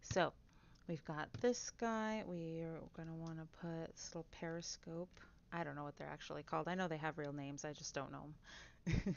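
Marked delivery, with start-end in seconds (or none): none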